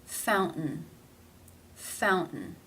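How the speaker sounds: "Fountain" with the T held as a stop before N. Shown as a demonstration. Teacher showing T before schwa and N, stopped in "Fountain". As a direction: stop the air on the T in "Fountain", no release. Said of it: "it is pronounced as a stop".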